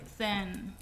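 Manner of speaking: ordinary talk, nothing marked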